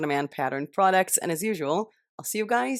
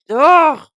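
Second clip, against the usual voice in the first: loud, higher frustrated ugh; frustrated groan; 10 semitones higher, 15 dB louder than usual. Real, not acted. Vlogger hot under the lamps, loud frustrated groan. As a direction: let out a big exasperated groan.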